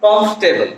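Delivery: কমফোর্টেবল squeezed to "komf-tebol"; correct pronunciation. Shown as a demonstration. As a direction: drop the "or" in কমফোর্টেবল, komf-te-bol. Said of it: "একইভাবে এখানে কি". (শুদ্ধ উচ্চারণে কমফোর্টেবল, "কমফ-টেবল")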